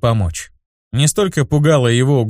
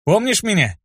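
cocky challenge; 8 semitones higher than usual. A narrator's reading. Hero taunts the leader.

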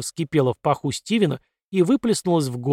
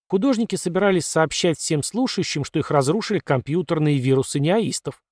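plain reading voice

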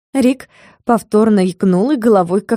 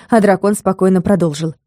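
usual speaking voice

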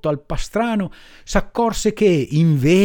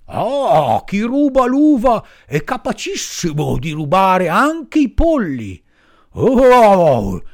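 loud, threatening farmer voice; angry farmer; 8 semitones higher, 4 dB louder than usual. Acted. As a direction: threaten the little thief.

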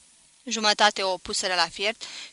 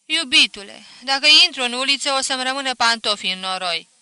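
louder, higher bride's protest; coy reluctance; 5 semitones higher, 9 dB louder, faster than usual. A narrator's reading. Bride hesitating at the muddy street, coyly protesting. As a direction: balk a little, high and lively. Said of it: "fata se codise".

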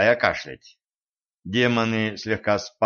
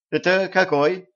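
high, bright eager question; 8 semitones higher than usual. A narrator's reading. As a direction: ask it eagerly. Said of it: "радостно поинтересовался самый молодой".

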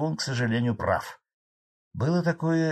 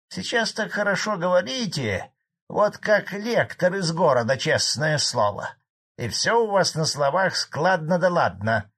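higher, mocking peasant complaint; accusing old farmer; 6 semitones higher, 4 dB louder than usual. A narrator's reading. Old farmer challenges the chairman's fine words, accusing. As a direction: needle the smooth talker in charge.

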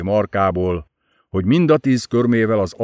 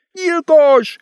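shrieked, much higher and louder; screaming; 5 dB louder, much slower than usual. Acted. Enid screams murderer at the narrator, shrieking high. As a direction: scream the accusation at him.

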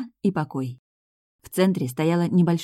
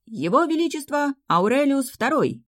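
loud proclamation; 10 semitones higher than usual. A narrator's reading. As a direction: herald announcing grandly, pitched high. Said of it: "громогласно провозгласил лакей".

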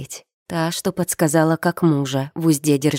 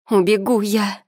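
higher, lightly sobbed protest; tearful defiance with raised pitch; tearful outburst; 6 semitones higher than usual. A narrator's reading. Daughter threatening her mother to run away, tearful. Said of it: "всхлипнула она".

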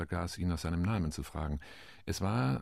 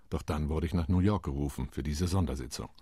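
ordinary talk

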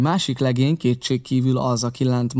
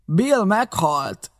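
high, slow call from indoors; startled, drawn-out cry; 7 semitones higher, much slower than usual. A narrator's reading. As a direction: call the news out to those outside. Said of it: "valaki kiszólt a házból".

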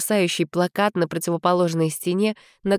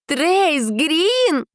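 excited squeal; 10 semitones higher than usual. Acted. Giselle exclaims.

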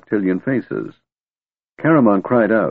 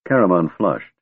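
usual speaking voice

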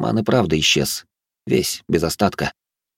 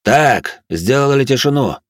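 raised, firm call for silence; sharp leader's command, pitch up; 6 semitones higher, 4 dB louder, slower than usual. A narrator's reading. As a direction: order the squad to shut up. Squad leader silences his bickering men.

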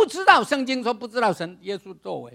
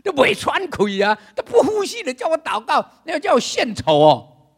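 voice of doubting reason; 4 semitones higher, 7 dB louder than usual. Retold or imitated. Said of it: "你的理智会告诉你".

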